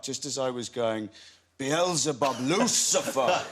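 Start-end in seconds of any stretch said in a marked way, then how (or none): 1.6-3.4 summoning the devil. Retold or imitated. Actor recalling rehearsal, booming demonic invocation. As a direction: call out to Lucifer like a stage sorcerer.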